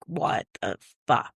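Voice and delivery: freaked-out whisper. Retold or imitated. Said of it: "like, freaked out".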